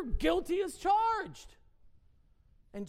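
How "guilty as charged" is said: loud, high, drawn-out accusation; accusing prosecutor. Acted. Preacher acting the enemy as prosecutor, loudly accusing. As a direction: pronounce the charge forcefully, as a prosecutor. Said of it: "as our enemy would look as a prosecutor".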